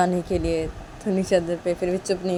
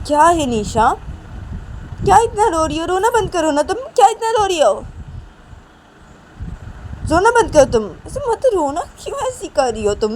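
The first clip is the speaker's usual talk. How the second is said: high, loud telling-off; exasperated scolding; 12 semitones higher, 6 dB louder than usual. A narrator's reading. Manisha to crying Nisha, exasperated scolding. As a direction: act fed up with someone's crying.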